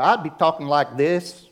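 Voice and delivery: slow Southern Georgia drawl; Georgia accent. Shown as a demonstration. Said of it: "If I was raised in Georgia".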